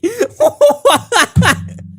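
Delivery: shrieking giggle burst; high laughter. Real, not acted. Reacting to the DM, a high laughing burst.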